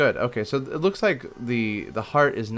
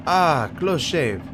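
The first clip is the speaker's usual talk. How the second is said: put-on slow, higher, louder French accent; delighted exclamation in French accent; 7 semitones higher, 5 dB louder, much slower than usual. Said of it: "gave him the French accent".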